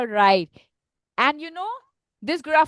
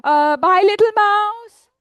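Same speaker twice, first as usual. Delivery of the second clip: high, loud, drawn-out goodbye; nervous snake; 7 semitones higher, 7 dB louder than usual. Acted. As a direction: blurt a hesitation, then stretch it high. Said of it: "And then he says".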